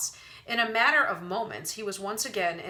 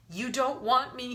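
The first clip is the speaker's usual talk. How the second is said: a touch of tearful pleading; half-sobbed plea; mild sob; 6 semitones higher than usual. A narrator's reading. Wendell begging the vampire, lightly sobbing. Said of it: "he sobbed".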